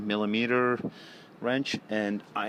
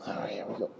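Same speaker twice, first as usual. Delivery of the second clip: whispering; 5 dB quieter, faster than usual. Real, not acted. Tense moment, oil about to drain.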